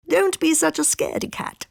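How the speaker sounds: high, teasing fox voice; chiding fox. A narrator's reading. Fox teases the kitten from behind a tree, high chiding. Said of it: "chided a fox from behind a tree".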